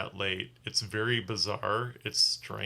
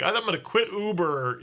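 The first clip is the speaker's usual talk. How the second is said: higher mock declaration; fed-up outburst; 8 semitones higher, 3 dB louder than usual. Driver grossed out after cleaning, fed up. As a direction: announce it like you're done for good. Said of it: "And, like".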